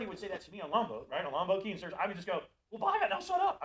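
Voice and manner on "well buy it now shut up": high, loud, cheeky mock-blunt quip; a sales tech teasing a customer, high and loud; joking bluntness. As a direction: toss "buy it, now shut up" off as a brash joke. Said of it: "I would say it like that as a joke".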